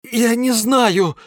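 high, loud, slow desperate cry; despairing. A narrator's reading. Sleepy botanist answering the bonsai, in despair. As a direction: cry out helplessly. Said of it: "в отчаянии ответил".

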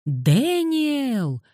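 stretched, pleading high cry; desperate wail. A narrator's reading. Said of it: "Крик перерос в отчаянный вопль".

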